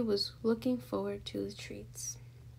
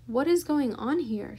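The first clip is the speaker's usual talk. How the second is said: loud, indignant demand; shout; 3 semitones higher, 9 dB louder than usual. A narrator's reading. Eugenia finds candy on the floor, loud shout. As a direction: demand an answer in a raised voice. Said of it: "shouted Eugenia".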